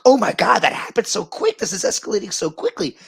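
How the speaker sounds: imitating coworkers' high, loud excitement; freaking out. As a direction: pitch it way up, voicing everyone losing it. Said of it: "freaking out".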